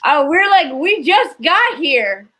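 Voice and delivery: normal talking voice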